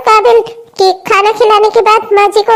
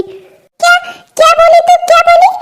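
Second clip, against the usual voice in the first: high-pitched, outraged challenge; indignant mother-in-law, affronted by back talk; angry; 6 semitones higher than usual. Acted. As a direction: demand it in sharp disbelief. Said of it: "आप इतने गुस्से में क्यों हैं".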